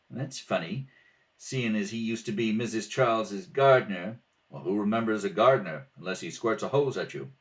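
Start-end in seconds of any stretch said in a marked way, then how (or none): none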